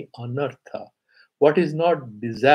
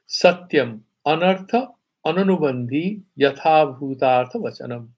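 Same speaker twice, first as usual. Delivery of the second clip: verse recitation; 5 dB louder than usual. Shown as a demonstration. Recites Sanskrit verse line.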